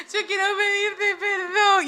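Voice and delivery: high, slow, tearful voice; young singer apologising to her leader in tears; tearful apology. Retold or imitated. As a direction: plead for forgiveness through tears. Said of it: "empecé a llorar delante de ella".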